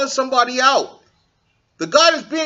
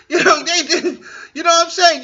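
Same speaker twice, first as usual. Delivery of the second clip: laughing; 8 semitones higher than usual. Real, not acted. Laughs mid-sentence.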